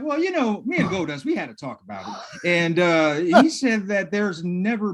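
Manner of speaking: high, sheepish mock Owen voice fading into a laugh; mock Owen Hart. Podcaster voicing Owen Hart dodging Jim Ross's question, high and sheepish. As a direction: play Owen squirming through an awkward answer.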